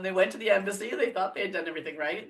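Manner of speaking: normal talking voice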